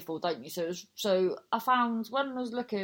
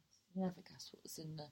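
whispered; 2 semitones lower, 12 dB quieter, faster than usual. Retold or imitated. Boy tells the teacher a secret.

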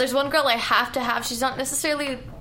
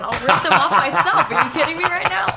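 laughing; 6 semitones higher, 6 dB louder than usual. Real, not acted. Co-host laughing as she boasts on air, laughing loud. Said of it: "laughing at own bold claim".

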